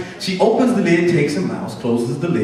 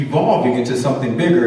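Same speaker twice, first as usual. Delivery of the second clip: ordinary delivery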